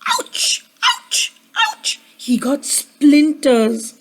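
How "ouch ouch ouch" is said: short, high squeaked "ouch"; pained ouches. A narrator's reading. Douglas got splinters from the tree, squeaky ouches. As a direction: yelp softly at each little prick.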